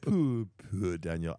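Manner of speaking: slow, put-on Irish accent; Alan. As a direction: draw it out in a mock Irish voice. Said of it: "I give him an Irish one".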